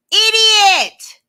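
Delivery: loud, high yell of Idiot; shouted insult. Real, not acted. Recapper yelling at Lenny on the TV, loud. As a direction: bark it at the screen in disbelief.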